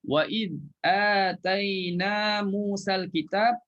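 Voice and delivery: slow chanted recitation running on; tajwid recitation. Shown as a demonstration. Ustaz showing the joined reading, slow melodic chant. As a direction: recite slowly, carrying on past kitab. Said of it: "Kalau kita sambung".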